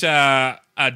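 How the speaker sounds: ordinary delivery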